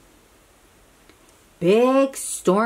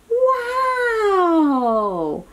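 excited wow; 11 semitones higher than usual. Real, not acted. Sees Saturn's rings.

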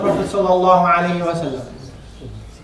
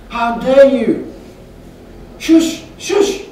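loud, slow outrage, then a high hissed shush; indignant scolding; 5 semitones higher, 4 dB louder, much slower than usual. Retold or imitated. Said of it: "started shouting at him".

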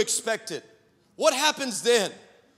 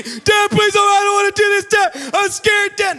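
high, loud mock-terrified wail; crying plea; 10 semitones higher, 9 dB louder than usual. Scared daughter begging dad to let her off, mock crying. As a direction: plead like a panicking little girl. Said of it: "Crying, shaking".

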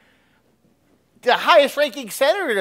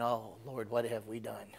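hushed, low inward prayer; a soft, low thought of dread, retold; awed dismay; 9 semitones lower, 16 dB quieter than usual. Recalling his own chilled thought about the nation, awed dismay. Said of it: "getting chills down my spine thinking".

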